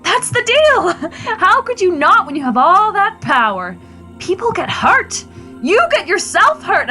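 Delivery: high-pitched, worked-up outburst; heated argument. Acted. Wife confronting husband about his power, worked up and high. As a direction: argue it hotly, voice climbing.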